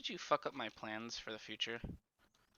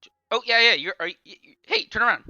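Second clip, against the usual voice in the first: excited; 9 semitones higher, 20 dB louder than usual. Real, not acted. Spotting a stranger in game.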